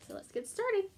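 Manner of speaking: ordinary delivery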